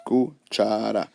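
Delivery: "cuchara" broken up syllable by syllable; divided into syllables. Shown as a demonstration. Teacher pointing out syllables to count, "cuchara" said in separate parts. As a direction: pause between the syllables of "cuchara". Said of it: "Separate the word".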